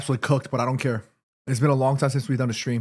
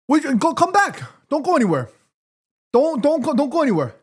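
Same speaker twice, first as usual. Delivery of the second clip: playful calling out; 10 semitones higher than usual. Acted. Playfully calling someone back.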